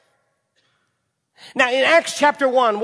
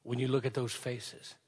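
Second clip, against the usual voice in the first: hushed questions; 8 semitones lower, 10 dB quieter than usual. Acted. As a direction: ask each one quietly, letting it sink in. Preacher's hushed questions to congregation.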